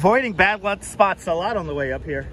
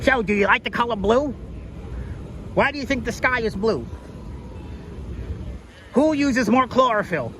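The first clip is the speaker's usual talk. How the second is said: lightly higher, playful TikTok interviewer voice; mock street interviewer; 5 semitones higher than usual. Imitating a TikTok street interviewer quizzing random people, playful. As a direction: ham up a street quiz host. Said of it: "asking random people question videos".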